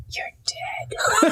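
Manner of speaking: menacing stage whisper; hushed, breathy death threat. Acted. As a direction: whisper it slowly into someone's ear. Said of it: "I whisper and I was like".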